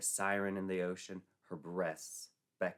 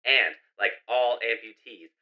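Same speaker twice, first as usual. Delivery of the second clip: loud, fast emergency radio bulletin; radio announcement; 4 semitones higher, 13 dB louder than usual. Acted. Radio warning residents about psycho, loud announcer voice.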